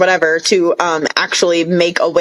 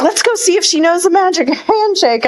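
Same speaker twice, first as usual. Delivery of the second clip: scheming quote; 9 semitones higher than usual. Retold or imitated. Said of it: "And she's like".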